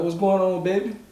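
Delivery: put-on high, tender parent-to-child question; gentle concern. Father gently greeting worried daughter.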